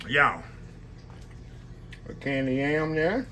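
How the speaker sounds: ordinary talk, nothing marked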